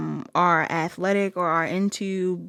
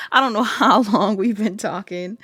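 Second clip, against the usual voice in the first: laughing through the words, louder; giggling as it spills out; 5 dB louder than usual. Real, not acted. Laughing while describing being lost in time with this person. Gushing about losing track of time.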